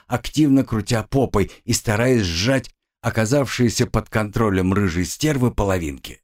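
ordinary delivery

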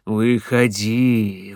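slow, low, coaxing call; luring call. Acted. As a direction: draw the word out to lure her. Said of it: "зазывал голос".

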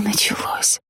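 soft breathy whisper. A narrator's reading. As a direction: whisper it in awe. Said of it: "прошептала я".

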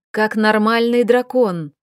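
ordinary delivery, nothing marked

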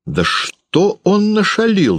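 high, slow, drawn-out demand; exasperated high-pitched questioning; interrogating father. A narrator's reading. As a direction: press for an answer, voice pitched right up. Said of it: "допрашивал отец".

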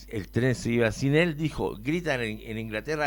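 normal talking voice

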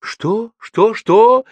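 mother's escalating shrieked disbelief; crying out. A narrator's reading. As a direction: fire off the question, building to a shout. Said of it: "выкрикивала мама".

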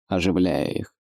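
plain reading voice

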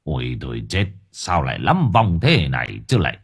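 an old exorcist muttering at a bad sign; dismayed old master. A narrator's reading. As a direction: master seeing many ghosts at the gate, dismayed. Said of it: "đứng sững lại nhăn mặt".

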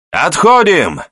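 high, loud barked command; рявкающий приказ. A narrator's reading. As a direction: bark it out to the men. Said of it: "рявкнул Ахмед". (рявкнуть команду)

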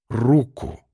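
slow, measured repeated order; slow warning. A narrator's reading. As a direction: draw the word out, restrained. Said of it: "медленно повторил я". (медленно, с расстановкой)

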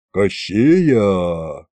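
low, sinking exclamation; dismayed sigh. A narrator's reading. Officer learns whose envoys they are, dismayed sigh. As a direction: let it out as a sigh. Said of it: "вздохнул Макс".

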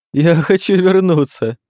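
high, drawn-out tearful whine; whining. A narrator's reading. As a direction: whimper it, close to sobbing. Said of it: "заскулил Жером".

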